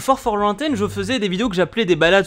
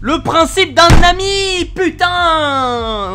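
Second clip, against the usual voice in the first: exasperated shout; 10 semitones higher, 7 dB louder, much slower than usual. Real, not acted.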